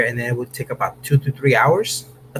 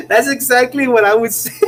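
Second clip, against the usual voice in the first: much higher, words squeezed out through laughter; laughing; 11 semitones higher, 3 dB louder than usual. Real, not acted. Streamer agrees with the comment while still cracking up. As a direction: barely get the words out for laughing.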